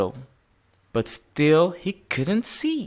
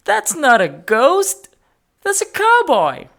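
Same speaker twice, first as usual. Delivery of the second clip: laughing; 12 semitones higher, 6 dB louder than usual. A narrator's reading. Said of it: "laughed a policeman".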